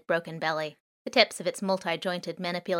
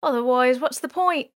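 higher, louder overdone pleading; milk the sympathy; laying it on thick; 6 semitones higher, 6 dB louder than usual. Acted. Boy to the drone, overdoing the sob story. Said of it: "laying it on a bit thick".

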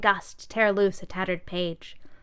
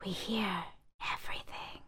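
whispering; 12 dB quieter than usual. Acted. Bag whispering.

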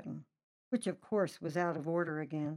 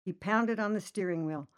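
usual speaking voice

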